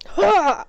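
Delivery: loud high scornful "Ha!"; one sharp loud derisive laugh; mocking bark of laughter. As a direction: scoff loudly in the modder's face. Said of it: "I'm gonna be like".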